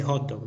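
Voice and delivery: "hot dog" as "jot dog" with Spanish O; "hot dog" with a rasped Spanish J and O; cholito pronunciation. Retold or imitated. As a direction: Hispanicize "hot dog", rounded O in "hot". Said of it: "cholito que la gente dice".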